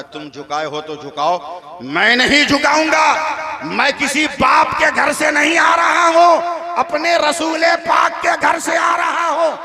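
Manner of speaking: starts slow and firm, rising to a loud high shout; defiant boy, building to a shout. Acted. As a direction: chest out, swelling into a proud cry. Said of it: "लड़का सीना तान के बोलता है". (धीमे दृढ़ स्वर से ऊँची ललकार तक)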